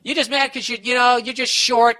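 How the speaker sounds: whiny jab at the husband; mocking wife. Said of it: "she starts going".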